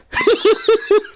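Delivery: loud high Joker cackle; maniacal laugh. Retold or imitated. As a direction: burst into a crazy clown laugh. Joker laugh after good laugh.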